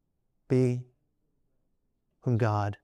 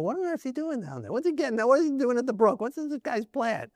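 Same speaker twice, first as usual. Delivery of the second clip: mock bewilderment, pitched up; baffled onlookers; 8 semitones higher than usual. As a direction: wonder aloud as bewildered bystanders. Voicing the puzzled army watching David.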